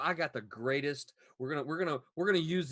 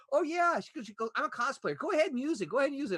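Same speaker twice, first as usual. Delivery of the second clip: imitating the mom; 11 semitones higher, 4 dB louder, faster than usual.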